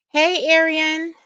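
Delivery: normal talking voice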